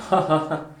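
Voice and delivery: coworker's amused little laugh, imitated. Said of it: "she sort of laughed and went".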